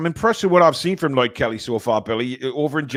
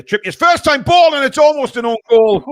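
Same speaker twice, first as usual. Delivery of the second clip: excited; 13 semitones higher, 11 dB louder than usual. Real, not acted. Near own goal, live reaction.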